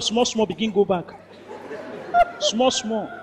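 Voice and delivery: softly narrating a sneak to the back; hushed sneaking. A narrator's reading. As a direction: tiptoe the words in a hush.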